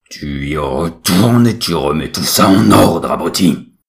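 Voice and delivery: loud, slow roared order; booming divine wrath, drawn out; suddenly furious. A narrator's reading. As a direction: thunder the command at your idiot son. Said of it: "La voix devient subitement colérique".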